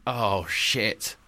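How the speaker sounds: dropped low and soft, mock dread; sinking realisation. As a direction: let the voice fall on a comic realisation. Acted president realises his mistake.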